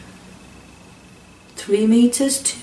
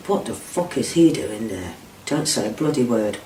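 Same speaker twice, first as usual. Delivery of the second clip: low menacing hiss; 5 semitones lower, 9 dB quieter than usual. Retold or imitated. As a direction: lower and much softer, a threatening hiss. Captor threatens him quietly.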